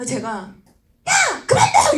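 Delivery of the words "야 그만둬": high, loud mock yell; imagined angry outburst. Upline wishing she could tell partner to quit, mock yell. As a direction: let rip with pretend fury.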